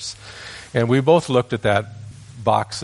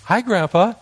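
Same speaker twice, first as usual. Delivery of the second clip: put-on high, caught-out little boy; sheepish child; 11 semitones higher, 4 dB louder than usual. Caught grandson greeting grandpa, high child voice.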